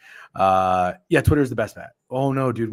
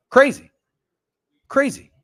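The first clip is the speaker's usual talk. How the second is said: high, loud mock gasp of crazy; sarcastic high-pitched crazy; mock shocked; 13 semitones higher, 8 dB louder than usual. Real, not acted. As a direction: squeal crazy in mock disbelief. Mocking exclamation.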